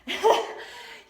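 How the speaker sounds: short loud laugh; brief breathy laugh. Real, not acted. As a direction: burst out in a high, quick laugh. Laughs after her quip.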